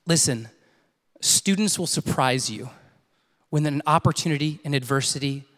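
low, measured, weighty plea; earnest appeal. Real, not acted. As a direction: drop the pitch and pause between phrases. Preacher's solemn appeal after story.

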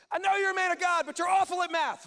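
high, loud incredulous protest; hammed-up outraged disbelief; exasperated shout. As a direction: yell it like a baffled servant. Servant protests to Elisha, exasperated.

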